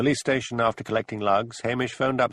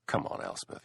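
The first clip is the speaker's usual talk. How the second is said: hushed; 8 dB quieter than usual. Acted. Hamish brushing Elspeth off.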